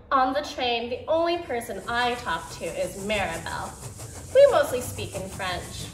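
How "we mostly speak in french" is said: plain reading voice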